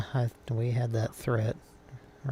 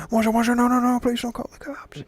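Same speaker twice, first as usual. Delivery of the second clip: high, loud pleading voice; Moja voice; 13 semitones higher, 8 dB louder, faster than usual. Retold or imitated. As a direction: beg in a much higher, louder voice. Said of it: "and I was like".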